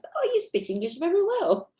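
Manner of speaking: high, wide-eyed praise of her English; surprised quote. Retold or imitated. A stranger praising her English, high surprised mimicry. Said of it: "then they see you, like".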